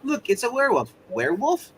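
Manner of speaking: high, swooping mock-puzzled questions; werewolf joke routine. Player acts out the werewolf joke he feels they missed, mock puzzled.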